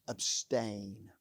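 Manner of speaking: quiet emphasis. Shown as a demonstration. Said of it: "Listen to what he says".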